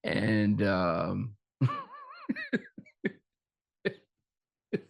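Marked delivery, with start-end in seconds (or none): 1.7-2.8 high laugh. Real, not acted. Laughs through pitch effect.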